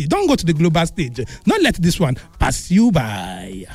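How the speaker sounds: lively pidgin promo voice; upbeat sponsor announcement; radio ad read. Acted. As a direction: sell it to the listeners.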